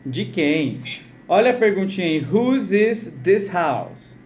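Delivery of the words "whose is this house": "Whose is this house?" very slow, word by word; slow model reading. Shown as a demonstration. Teacher to students repeating the question, very slow model. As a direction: stretch out each word for repeating.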